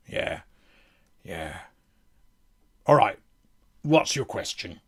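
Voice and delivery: deadpan in-character refusal; world-weary fighter. Acted. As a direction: turn questions down in short, low replies. Fighter fields a question.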